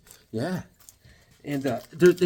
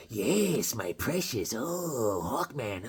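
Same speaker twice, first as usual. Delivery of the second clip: low, soft gloating over a treasure; mock gloating creature; 5 semitones lower, 9 dB quieter, much slower than usual. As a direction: playfully croon over your precious. Gloating over treasured cup, Gollum quote.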